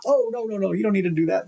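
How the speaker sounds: high, hurried no no no; flustered protest. Retold or imitated. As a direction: rush to stop her, flustered. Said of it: "And I'm like".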